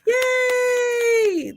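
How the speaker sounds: high happy yay; excited cheer. Real, not acted. Cheering for the kid.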